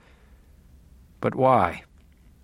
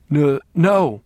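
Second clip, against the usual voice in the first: stammering; 3 semitones higher, 5 dB louder than usual. Acted. Captive stammers in fear.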